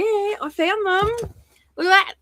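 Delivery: high, slow delighted reveal; thrilled to find them; excited. Real, not acted. Finding lost flowers.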